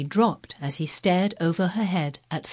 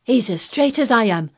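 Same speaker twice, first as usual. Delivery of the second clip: higher, loud Yorkshire lad; raised-voice country boy's affirmation; cried out; 5 semitones higher, 5 dB louder than usual. A narrator's reading. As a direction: cry it out stoutly. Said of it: "cried Dickon".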